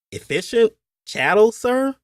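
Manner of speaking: high, rising puzzled question; puzzled Appalachian overseer. A narrator's reading. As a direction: play a baffled overseer, voice climbing. Overseer puzzled by employer's phrase.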